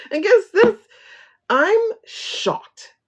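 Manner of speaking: high, loud exclaimed surprise; mock shock. Real, not acted. Telling viewers, a hammed-up shocked cry. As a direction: play up being shocked.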